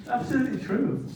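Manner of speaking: ordinary delivery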